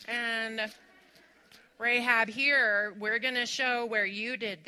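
lightly voiced scolding judge; a hint of a self-righteous accuser; judgmental church voice. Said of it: "They didn't stand there and go".

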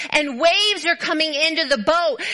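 ordinary talk

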